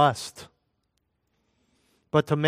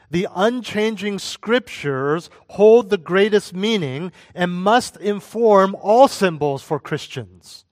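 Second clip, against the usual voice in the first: preaching; 5 semitones higher, 6 dB louder than usual. Acted. Pastor urging married Christians, preaching at full force. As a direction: drive the point home from the pulpit.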